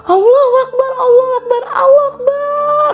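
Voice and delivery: high, rising cries of Allahu Akbar; building, desperate prayer yell; tearful shout. Retold or imitated. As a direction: scream it three times in fear. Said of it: "aku teriak kenceng banget sambil nangis".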